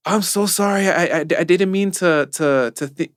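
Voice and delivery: high, fluttery, embarrassed; retelling the worker's panicked apology; flustered apology. Retold or imitated. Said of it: "And he was like".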